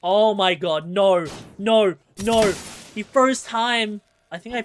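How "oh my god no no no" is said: loud, rising oh-my-god panic; dismayed cry. Real, not acted. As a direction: cry out as the fight goes wrong. Dismay at a game hit.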